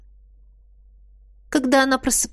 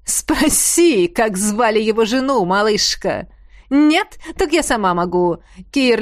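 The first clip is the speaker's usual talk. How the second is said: taunting witch voice; 3 dB louder than usual. Acted. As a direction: goad him like a cruel witch. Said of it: "ведьму".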